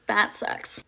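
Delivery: breathy whispered complaint; soft disappointed whisper; whispered gripe. Real, not acted. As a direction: mutter it under your breath. Sulking over missing Culver's.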